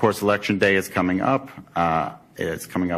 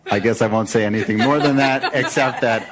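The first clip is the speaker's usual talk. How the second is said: laughing; 4 semitones higher, 5 dB louder than usual. Real, not acted. Laughing while praising a kid.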